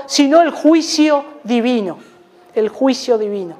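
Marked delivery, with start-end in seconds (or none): 2.5-3.5 soft echo. Real, not acted. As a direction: repeat "el juicio divino" softer, dropping away. Eco de la frase. (eco suave de "el juicio divino")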